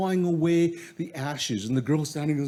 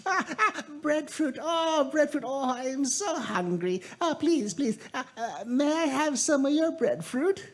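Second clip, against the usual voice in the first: put-on high, pleading old woman voice; begging old woman; 11 semitones higher than usual. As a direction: whine for food like a hungry old crone. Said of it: "she's going, an old woman".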